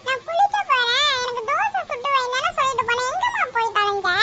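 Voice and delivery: put-on high, hungry child's whine; whining complaint. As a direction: nag mom in a high-pitched grumble. Hungry child nags mother for dosa.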